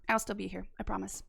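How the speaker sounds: normal talking voice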